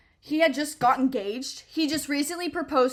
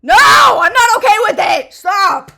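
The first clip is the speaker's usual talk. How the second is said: loud, much higher indignant yell; shouted outburst; 11 semitones higher, 21 dB louder, slower than usual. Pretend. Refusing the manager's excuse, furious shout. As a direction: blow up at him, shouting. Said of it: "outburst at imagined coworker".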